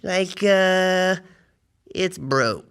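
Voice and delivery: drawn out, reluctant bad news; hesitant confession. Reluctantly confesses breaking borrowed toy.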